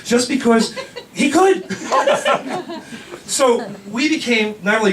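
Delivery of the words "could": high laugh. Real, not acted. Laughing at the bike story.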